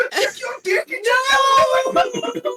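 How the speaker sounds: very high, loud, slow laughing shout; shouted taunt. Acted. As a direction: howl the taunt while pointing. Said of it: "he's going".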